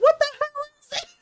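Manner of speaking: high, loud, quick mock yell; retold yelling. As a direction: imitate a friend yelling in outrage. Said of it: "he started yelling".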